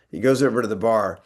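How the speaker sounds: usual speaking voice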